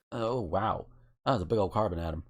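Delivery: normal talking voice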